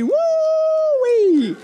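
long, very high whooping cheer; high celebratory whoop. Real, not acted. Celebrates the wedding party.

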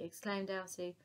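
usual speaking voice

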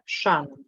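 Шанон with stress on ША, first syllable. Shown as a demonstration. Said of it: "Повторіть, запам'ятайте". (наголос на перший склад: ШАнон)

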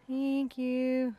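"Thank you" stretched out long; drawn-out thanks. Real, not acted. Painter thanking the camera helper, drawn out. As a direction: draw out both words of "Thank you".

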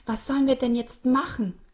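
voiced despair, not whispered; desperate. A narrator's reading. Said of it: "flüsterte Frau Schneeglöckchen verzweifelt".

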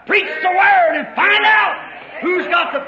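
high, loud exhortation; preaching shout. Real, not acted. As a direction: shout the charge to the congregation. Preacher exhorts at full force.

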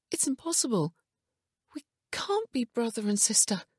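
high-pitched, disbelieving protest; distressed. A narrator's reading. Wife denying they are brother and sister, distressed.

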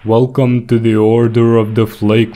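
ordinary talk, nothing marked

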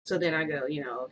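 plain reading voice

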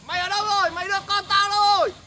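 angry yelling. Acted. Father storms in demanding child.

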